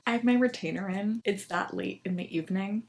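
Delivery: ordinary talk, nothing marked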